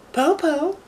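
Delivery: put-on squeaky child calling through a door; high small voice, wary; high little girl voice. Eldest daughter asks who knocks, in a high child voice.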